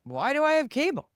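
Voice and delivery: high, baffled mock question; incredulous viewer. Viewers pushed to YouTube, asking in high mock disbelief.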